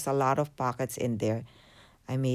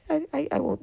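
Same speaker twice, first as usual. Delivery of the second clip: laughing; 13 semitones higher, faster than usual. Real, not acted. Laughs at own fumbling.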